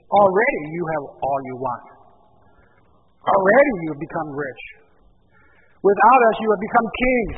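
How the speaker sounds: loud mocking irony; Paul mocking the Corinthians, loud and sarcastic. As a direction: drip with sarcasm at the proud church. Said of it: "Very sarcastic here".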